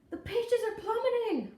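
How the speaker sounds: high, loud cry of alarm; shrill panicked yell; panicked shout. A narrator's reading. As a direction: yell as the raft sinks. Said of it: "my sister shouted".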